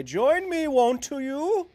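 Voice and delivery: put-on very high, loud old miner; prospector voice. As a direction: beckon viewers in like the old prospector.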